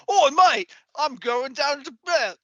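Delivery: high, loud, drawn-out mock British accent; bad British accent. Co-host mocking dub actors faking accents, bad British accent. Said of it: "I'm doing a bad job of it".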